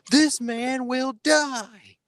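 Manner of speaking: hammed-up slow menacing declaration; dramatic villain. Imitating character's dramatic line.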